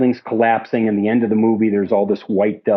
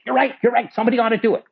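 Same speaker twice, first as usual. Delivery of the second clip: much higher, quick agreeing impression; mimicking Marty; 12 semitones higher, much faster than usual. Marty agrees the creature film should be remade, in a high voice. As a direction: nod along eagerly up high.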